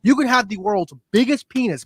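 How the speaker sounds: ordinary talk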